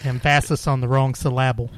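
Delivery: "emphasis" as em-FASS-is, "syllable" as sil-LAB-ul; wrong-syllable stress. Shown as a demonstration. Cohost echoing the joke about words, deliberately misstressed.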